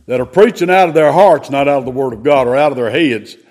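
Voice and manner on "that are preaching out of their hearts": ordinary talk, nothing marked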